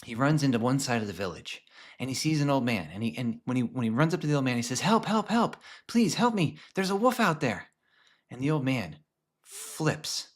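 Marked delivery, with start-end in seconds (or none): 4.8-7.7 high mock panic, calling for help; a boy faking alarm, pitched up; boy pretending a wolf attack, high panicky call; fake cry for help. Boy fakes alarm to old man.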